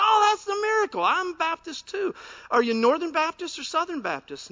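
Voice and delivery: high, loud delighted cry; overjoyed. Retold or imitated. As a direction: squeal with delight at the match. Overjoyed man in joke.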